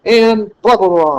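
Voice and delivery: run-on rhythm rising to a peak; rushing, building chain. Shown as a demonstration. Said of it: "that's kind of the rhythm of a polyscendenton".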